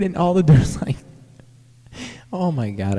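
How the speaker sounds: ordinary delivery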